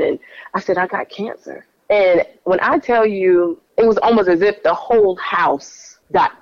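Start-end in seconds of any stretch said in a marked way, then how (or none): 0.8-1.7 tearful. Retold or imitated. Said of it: "through these cries and whimpering".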